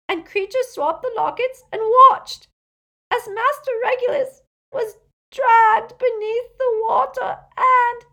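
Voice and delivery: high, loud elf wail, sobbing hard; great rasping sobs. A narrator's reading. Elf recalls his master dragged beneath the water, sobbing wail. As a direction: cry the words out, barely understandable. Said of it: "Creature's sobs came in great rasps now".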